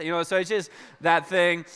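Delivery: ordinary talk, nothing marked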